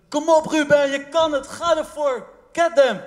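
high, loud cheering from the rinkside; coach calling out. Retold or imitated. Coach at the rink cheering his skater on, loud call. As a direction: shout him on like a coach at the ice. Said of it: "coaches die langs de ijsbaan stonden en riepen".

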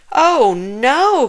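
lightly acted child's dread; dismayed cry. A narrator's reading. Zack hears he is getting a shot, dismayed cry. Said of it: "cried Zack".

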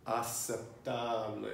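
"Aceptable" slowly, ah-sep-TAAH-bleh, with no k sound; careful pronunciation. Shown as a demonstration. Teacher correcting a student, "Aceptable" slow with only an s sound. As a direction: draw out "Aceptable" syllable by syllable, plain s. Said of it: "let's think about that one".

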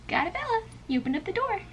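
high soft repeated cat greeting; cooing at the cat. Real, not acted.

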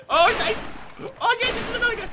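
very high, loud yelp; alarmed. Real, not acted. Gamer warning teammate of another enemy, high loud alarm.

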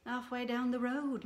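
normal talking voice

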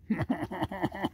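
a short breathy chuckle; soft delighted laugh. Real, not acted. Delighted chuckle as the raccoon takes food from his finger.

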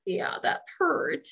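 high, slow breathy whimper; mock whimper of a hurt child. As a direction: imitate a toddler whimpering after a fall. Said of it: "like, whimpering".

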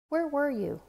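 high, anxious question; worried child. A narrator's reading. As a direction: ask with relief and worry. Worried child greets father.

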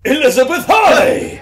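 high, loud blurted name; sudden shout of the name. Acted. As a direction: bellow it out proudly.

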